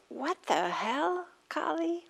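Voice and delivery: slow, high, fed-up demand; exasperated. Retold or imitated. Mistress confronting her late-rising maid, slow and exasperated. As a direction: drag it out, rising high on Kali. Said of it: "another translator says".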